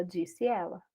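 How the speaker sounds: normal talking voice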